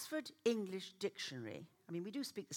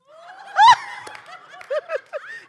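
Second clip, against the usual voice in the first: shrieking laugh; 21 dB louder than usual. Real, not acted. Laughing at the Lord's joke.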